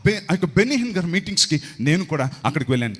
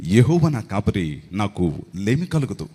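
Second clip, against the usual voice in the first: lower, slow, like a film dialogue; dialogue delivery; 7 semitones lower, slower than usual. Retold or imitated. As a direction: deliver the verse like a movie line. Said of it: "dialogue చెప్తున్నట్టుందరా". (Dialogue లాగా)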